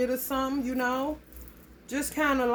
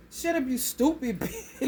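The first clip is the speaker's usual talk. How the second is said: imitated kid, breaking into laughter; 2 semitones higher than usual. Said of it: "that voice sound like they was about four or five".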